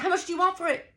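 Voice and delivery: high-pitched, insistent question; heated demand. Retold or imitated. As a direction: demand a price mid-argument, pitch right up. Demanding price in acted quarrel.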